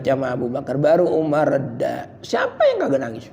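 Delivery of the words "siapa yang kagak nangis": high fast challenge; who wouldn't cry?; rhetorical challenge. Acted. Rhetorical question. (menantang, cepat dan tinggi)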